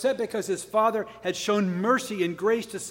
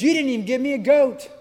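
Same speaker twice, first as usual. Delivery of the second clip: higher, louder whiny grievance; petty complaint; 6 semitones higher, 6 dB louder than usual. Retold or imitated. Jealous son griping at his dad, complaining. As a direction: complain like a sulking child. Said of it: "critical and complaining".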